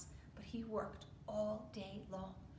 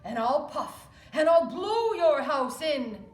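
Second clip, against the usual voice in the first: much louder, slow wolf threat; threatening wolf; 3 semitones higher, 13 dB louder, slower than usual. Acted. Wolf threatening the pig's straw house, loud and slow. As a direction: roar the threat slowly. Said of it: "said the wolf".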